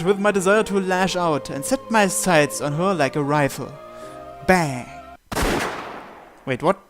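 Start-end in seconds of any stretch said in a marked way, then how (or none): none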